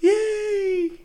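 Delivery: high, drawn-out squealed yay; put-on shrill hooray; mock cheer. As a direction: cheer like an overexcited party guest. Mock cheer for child's party.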